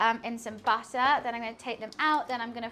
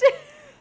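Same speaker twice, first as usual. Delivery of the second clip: loud squealing laugh; laughing at herself; high burst of laughter; 12 dB louder than usual. Real, not acted. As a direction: break into a shrieking laugh.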